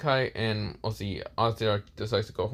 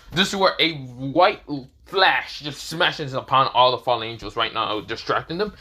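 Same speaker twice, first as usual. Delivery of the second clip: plain reading voice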